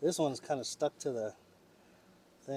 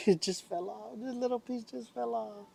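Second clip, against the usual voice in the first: laughing; 10 semitones higher than usual. Real, not acted. Laughing at piece falling.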